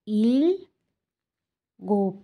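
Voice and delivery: ইলগোপ drawn out slowly as "il... gop"; syllable by syllable. Shown as a demonstration. Teacher sounding out Korean eight for learners, ইলগোপ split slowly. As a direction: break ইলগোপ into two long syllables with a pause.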